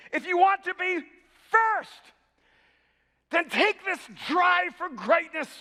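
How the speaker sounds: high, loud, impassioned sermon delivery; ringing pulpit declaration; full-force preaching. Acted. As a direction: preach it to the whole room, pitched right up.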